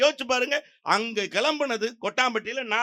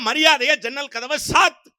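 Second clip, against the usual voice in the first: high, loud mock demand; demanding order; 7 semitones higher, 6 dB louder than usual. Second woman on a bus demanding the window shut, loud. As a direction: act out a bossy rival passenger.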